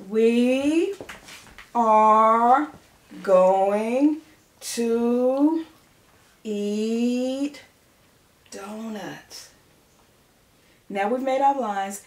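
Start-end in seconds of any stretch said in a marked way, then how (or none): 0.1-9.5 words spaced out while writing; slow, word by word, loud; dictation pace. Shown as a demonstration. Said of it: "Let's write".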